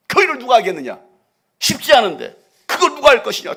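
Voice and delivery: loud, soaring preacher voice; full-force preaching. Acted. Preacher crying out to the congregation, loud and soaring. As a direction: preach it at the top of your lungs.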